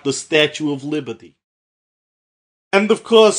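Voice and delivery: put-on Jersey character accent sliding into Russian; put-on New Jersey voice that drifts toward Russian; slipping Russian accent. Host introduces friends from the States, Jersey accent turning Russian. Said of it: "Why is it turning into a Russian accent?".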